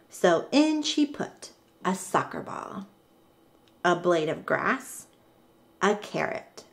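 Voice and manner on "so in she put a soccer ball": usual speaking voice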